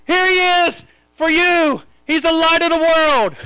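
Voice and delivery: high, urgent pulpit shout; fervent, high-pitched proclamation; impassioned preaching. Real, not acted. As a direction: cry it out to the whole congregation. Preaches at full force.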